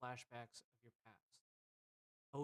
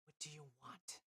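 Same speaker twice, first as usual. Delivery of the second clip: shadow-cloaked woman; 3 semitones higher, 3 dB quieter than usual. Acted. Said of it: "you hear her just go".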